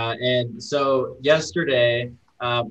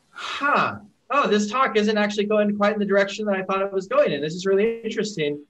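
higher-pitched puzzled realization; dawning doubt; 7 semitones higher than usual. Retold or imitated. Viewer realizing the talk goes another way, puzzled. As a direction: think aloud as it dawns on you.

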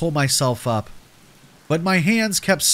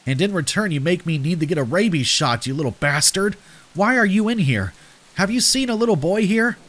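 higher-pitched, mildly angry questioning; exasperated scolding; 6 semitones higher than usual. Acted. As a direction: scold the child who bit you, pitch climbing into the questions. Bitten narrator scolds and questions girl.

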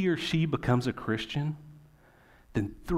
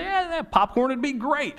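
1 mock eager guest; 14 semitones higher, 5 dB louder than usual. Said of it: "And you say".

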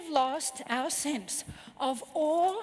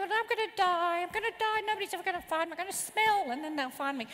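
pathetic whining adult; 4 semitones higher than usual. Retold or imitated. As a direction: whine like a pathetic lost grown-up. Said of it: "I'm being a pathetic adult".

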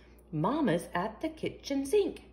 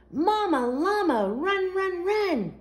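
much higher and louder, urging her on; urgent cheer; 8 semitones higher, 5 dB louder than usual. A narrator's reading. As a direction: cheer mama on to hurry. Urging mama to hurry.

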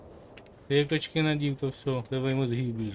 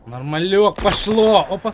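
loud, higher triumphant cheer; excited shout; 7 semitones higher, 13 dB louder, slower than usual. Real, not acted. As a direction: cheer when it works. Радіє успіху в грі. (збуджений вигук)

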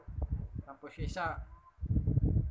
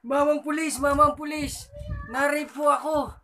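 put-on high, louder old lady calling; granny voice; 11 semitones higher, 7 dB louder than usual. Granny reporting to the police, high. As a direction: plead to the officer like a granny. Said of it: "Sabi niya".

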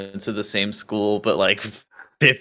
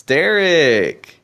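calling out; 7 semitones higher, 6 dB louder than usual. Real, not acted.

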